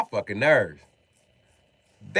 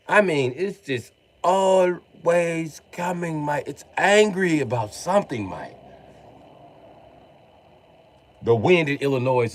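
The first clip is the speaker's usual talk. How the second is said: emphatic imitation of a London bloke; London accent; 3 semitones higher than usual. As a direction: complain like a Londoner, pushing out the key words. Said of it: "guys from London have told me".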